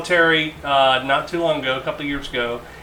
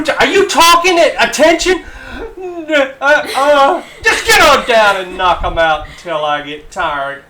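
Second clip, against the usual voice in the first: much higher, much louder mocking yell; drill sergeant tirade; 10 semitones higher, 8 dB louder than usual. Drill sergeant taunting the recruit before ordering push-ups, yelling. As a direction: rip into the recruit at full volume. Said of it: "And then he goes".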